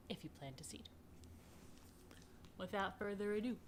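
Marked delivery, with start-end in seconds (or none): 0.1-0.9 whispered book title; hushed reveal of the book's name. Acted. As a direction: breathe the title like a secret. Announcing book title to children.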